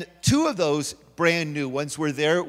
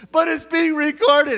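much higher, louder and slow worried objection; anxious protest; 10 semitones higher, 7 dB louder than usual. Retold or imitated. Wife fretting to her husband about the camera, high and anxious. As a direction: plead that it matters because it's recorded. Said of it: "she was so stressed".

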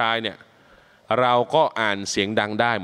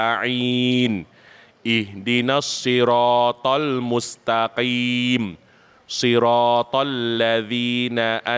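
melodic Arabic recitation. Shown as a demonstration. Imam showing students how to recite the opening prayer, melodic chant. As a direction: intone it like prayer, each phrase sung out.

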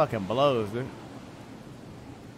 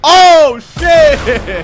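very high, very loud yell of shock; startled shout; 18 dB louder than usual. Real, not acted. Gamer caught off guard mid-fight, real shocked yell. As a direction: jolt out a scream. Sudden startled reaction in game.

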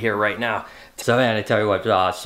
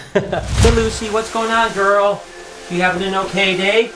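high sweet voice for a pet; pet talk; 9 semitones higher than usual. Real, not acted. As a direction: coo at a pet snake. Greeting his pet snake.

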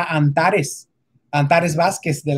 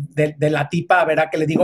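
normal talking voice

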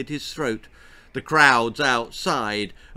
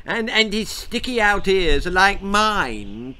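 put-on higher, louder twittering old lady; twittering grandma; 6 semitones higher, 6 dB louder than usual. A narrator's reading. Grandma claiming the ears, twittering. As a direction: chirp it like a fussy old grandma. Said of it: "twittered Grandma Wilhelmina".

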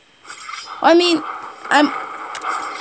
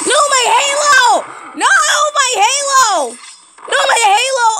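wailing dismay; 9 semitones higher than usual. Real, not acted. Player losing a precious halo, wailing.

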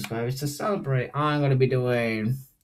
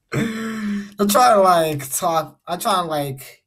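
laughing; 7 semitones higher, 3 dB louder, slower than usual. Real, not acted. Giggling while explaining the sleepy voice.

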